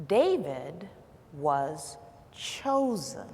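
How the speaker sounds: low, soft, deliberate counsel; hushed and reverent, slowing to the end; quiet, soothing. Retold or imitated. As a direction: imitate a friend gently imparting wisdom. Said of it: "in a very quiet and soothing voice".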